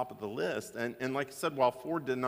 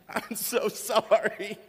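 imitating a kid sobbing out an apology, high and slow; half-mock blubbering, as the guilty boy; retold tearful apology; 10 semitones higher, 6 dB louder, slower than usual. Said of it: "through a lot of tears and snot".

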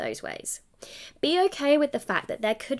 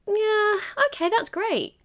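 underwhelmed; 12 semitones higher than usual. Retold or imitated. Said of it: "the energy I'm trying to kind of give across there".

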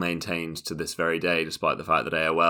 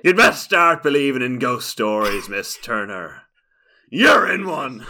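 menacing pirate, building to a shout; Barbossa; 6 semitones higher, 9 dB louder than usual. Pretend. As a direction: loom over her, then roar the end. Film quote, pirate.

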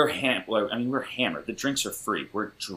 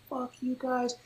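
pissed off; 11 semitones higher, 11 dB quieter than usual. Retold or imitated. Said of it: "He's pissed".

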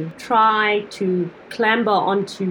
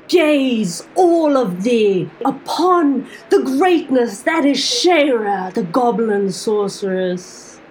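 grand proclamation; 2 semitones higher, slower than usual. Acted. Drunk goblin sorceress declaring herself to the tavern, grandly. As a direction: proclaim your greatness to the whole tavern.

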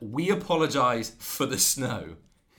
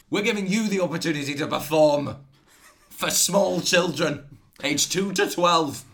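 loud, high mock-epic proclamation; mock rallying cry, pitched high and loud; hammed-up showman announcement; 6 semitones higher, 6 dB louder than usual. Acted farm rallying its performing animals.

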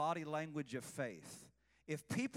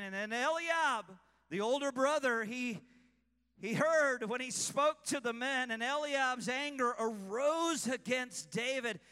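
raised, intense scripture reading; loud dramatic reading; 6 semitones higher, 7 dB louder than usual. Acted. Preacher reading Eliab's angry rebuke aloud. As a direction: read it like the scene is live.

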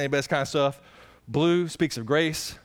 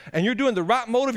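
normal talking voice

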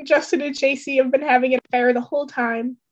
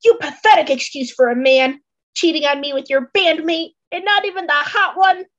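louder, rising scorn at the cheater; furious girlfriend berating him; angry accusation; 5 semitones higher, 5 dB louder than usual. Acted. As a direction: tear into him, climbing higher by the last line. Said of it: "points an accusatory finger".

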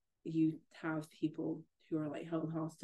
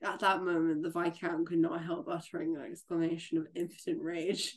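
lively read-aloud of a novel passage, a little louder; story reading; 4 dB louder than usual. A narrator's reading. Friend reads the Phantom novel aloud, livelier and louder. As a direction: read the scene to a friend, dramatically.

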